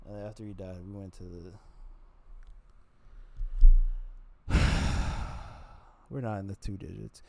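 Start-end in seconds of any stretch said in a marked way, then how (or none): 3.0-6.0 a long, loud blown-out exhale; loud heavy sigh. Real, not acted. Comic sighs loudly before admitting his count.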